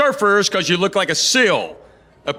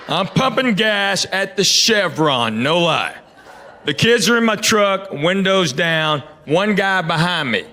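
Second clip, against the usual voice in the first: ordinary talk, nothing marked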